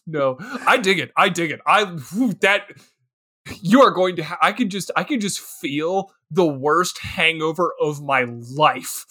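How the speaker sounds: ordinary talk, nothing marked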